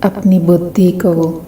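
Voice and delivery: unhurried, evenly pitched meditation voice; guided meditation. Acted. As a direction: guide listeners gently into stillness, phrase by phrase.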